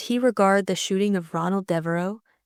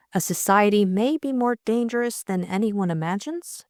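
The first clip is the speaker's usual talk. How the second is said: ordinary delivery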